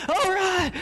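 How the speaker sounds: high, exuberant burst; acted eager kid. Jonathan answers the coach's call, high excited cry. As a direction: imitate a child leaping at his chance. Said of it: "And he goes".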